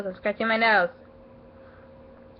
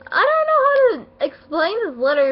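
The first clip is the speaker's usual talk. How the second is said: high choked-up voice, louder; overwhelmed and barely holding it together; near tears; 10 semitones higher, 4 dB louder than usual. Real, not acted. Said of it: "we're trying not to cry about it".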